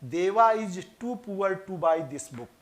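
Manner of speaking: very slow, careful reading of the example; slow example sentence. Shown as a demonstration. Teacher slowly reading the exam sentence to students. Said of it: "देखिए".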